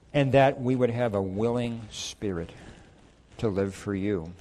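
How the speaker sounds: closing prayer. Acted. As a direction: pray humbly for the listeners. Pastor leads closing prayer.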